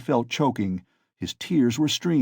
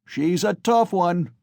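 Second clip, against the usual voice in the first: higher, louder, slow call; shouted; 7 semitones higher, 4 dB louder, slower than usual. A narrator's reading. Onlooker marvelling at the mare, slow higher shout. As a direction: call it out from the crowd. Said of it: "was shouted in the crowd".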